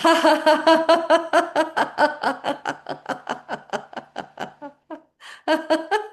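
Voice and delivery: long high string of ha-has on one breath; drawn-out forced exercise laugh; fake laughter. Shown as a demonstration. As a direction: laugh out every bit of held air. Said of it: "as many ha ha ha's as you can".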